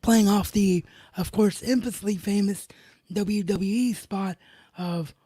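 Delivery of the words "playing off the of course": ordinary delivery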